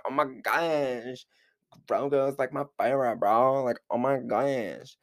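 dragged-out "oh my gosh", bubbly and giddy. Retold or imitated. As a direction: gush over the baby like a starstruck fangirl. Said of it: "that's my [name] impression".

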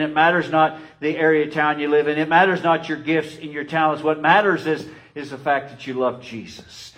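rising fervent preaching cadence. Acted. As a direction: preach it with building fire. Preaching at full force.

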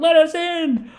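high, strained yell; mock mob shouting. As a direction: holler through a door like an angry gang. Mob shouting outside plane door.

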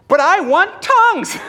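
high, drawn-out comic yell; hammed-up pleading holler, breaking into a laugh; demanding shout. Tim begs the Lord for tongues, hammed-up high shout. Retold demanding prayer, far louder.

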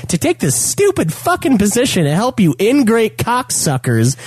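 high, building to a shout; tearing into the neighbour; furious rant. Real, not acted. Ranting about HOA neighbour.

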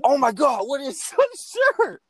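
high, loud outraged squeal; imitating a friend freaking out over his ruined shirt; angry Steve voice. Friend imitating Steve's outrage at the stained shirt, high and loud. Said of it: "Angry Steve voice".

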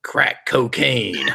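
slow reveal. Acted. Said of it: "He said".

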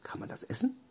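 soft, high little squeak of a question; tiny high voice, softly questioning; high-pitched question. A narrator's reading. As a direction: ask it small and high. Same question asked again, squeaky.

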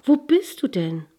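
high, loud searching question; eager call. A narrator's reading. Girl searches for the singer, calling out eagerly. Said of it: "rief es da aus".

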